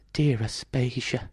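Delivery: soft, slow husky murmur. Acted. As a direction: murmur his name lovingly. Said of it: "Sally said huskily".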